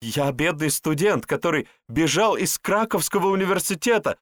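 high, loud, frantic pleading; panicked confession. Acted. Cornered man confessing who he is, panicked and high-pitched. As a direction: spill it desperately, climbing in pitch.

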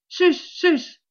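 high cry of men in pursuit; chase cry. A narrator's reading. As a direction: cry out to urge on the hunt. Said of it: "criaient Conan". (cri de poursuite, haut perché)

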